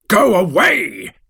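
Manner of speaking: slow, high shout at the monster. A narrator's reading. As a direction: drive him off with a shout. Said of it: "I shouted".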